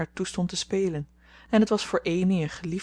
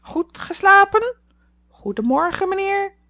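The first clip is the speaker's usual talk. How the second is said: solemn parrot; 14 semitones higher, 10 dB louder than usual. A narrator's reading. Parrot cocking its head at Laurie, squawking solemnly. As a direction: squawk it with mock gravity. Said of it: "zeer ernstig riep".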